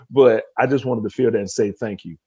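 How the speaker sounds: ordinary delivery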